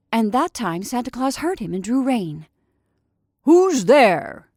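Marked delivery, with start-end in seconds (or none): 3.4-4.5 loud call into the darkness; shouted. Acted. Santa calls out from his sleigh in the dark, loud shout. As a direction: shout out, unsure who's there. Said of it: "he shouted out of the darkness".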